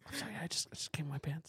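sheepish whispered apology. Pretend. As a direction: whisper it, mortified. Acted embarrassed confession.

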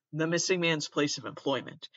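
normal talking voice

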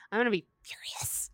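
hushed, breathy warning that she'll be furious; whispered threat. Real, not acted. Fan whispering a warning about a bad movie adaptation.

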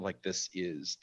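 ordinary talk, nothing marked

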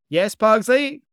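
high, loud reply; cheerful called answer; calling back. A narrator's reading. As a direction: call back across the sky. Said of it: "Gomez called back".

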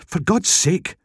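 exasperated, high-pitched huff; exasperated Scottish woman. A narrator's reading. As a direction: huff it out, fed up. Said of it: "the woman huffed".

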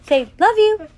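high, loud prompt to a little one. Real, not acted. Parent teaching a toddler first words, coaxing.

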